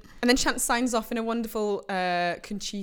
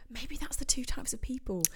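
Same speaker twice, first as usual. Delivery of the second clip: whisper; 5 semitones higher, 8 dB quieter than usual. Real, not acted. Unvoiced aside.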